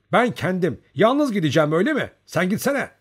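high, loud indignant retort; angry shout. A narrator's reading. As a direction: yell back in protest. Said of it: "Nazarka öfkeyle".

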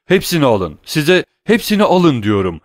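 loud, high giving-up cry; desperate shout. A narrator's reading. Danglars yelling at Vampa to take everything. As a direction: hurl it out in despair. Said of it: "diye haykırdı Danglars".

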